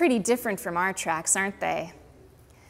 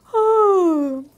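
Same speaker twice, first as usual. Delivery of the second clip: sharp, loud surprised gasp; 8 semitones higher, 10 dB louder than usual. Acted. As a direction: gasp in shock. Gasp in story.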